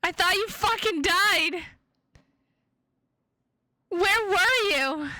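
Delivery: sobbing relief at a lost pet; slow, tearful cry of relief; tearful shout. Acted. Reunited with cat thought dead.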